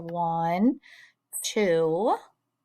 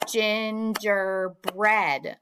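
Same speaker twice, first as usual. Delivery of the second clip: "Gingerbread" very slowly in three beats; syllable by syllable; much slower than usual. Shown as a demonstration. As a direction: clap out "Gingerbread" as gin-ger-bread. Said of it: "tell me the syllables in gingerbread".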